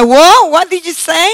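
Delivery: put-on father caught off guard by his toddler, startled and loud; startled whoa. Dad caught out by his son, surprised and loud.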